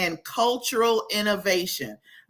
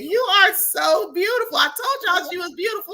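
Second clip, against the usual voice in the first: excited; 9 semitones higher than usual. Real, not acted. Gushing over her beautiful guest.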